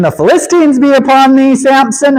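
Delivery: calling out. Acted. Delilah calls out to Samson.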